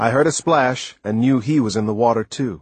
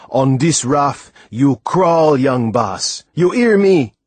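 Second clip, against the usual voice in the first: loud, slow commanding rebuke; stern anger; 4 semitones higher, 5 dB louder, slower than usual. Acted. Timothy orders the boy to crawl, stern angry command. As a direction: lay down the law, furious. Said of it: "His voice was thick with anger".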